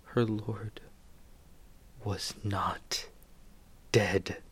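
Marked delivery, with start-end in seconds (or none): none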